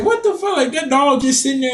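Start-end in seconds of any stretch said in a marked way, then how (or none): 0.0-0.6 much higher, disbelieving mock cry; squeaky what-the-heck yelp; shocked reaction. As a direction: react like you can't believe it. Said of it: "that was like".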